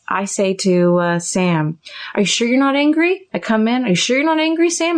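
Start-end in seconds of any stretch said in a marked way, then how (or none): 2.1-3.3 high, questioning lilt; probing concern. Acted. Therapist acting out projection onto Sam, probing concern. 3.9-5.0 high, leading question; insistent probing. Acted. Therapist pestering Sam about hidden anger, insistent probing.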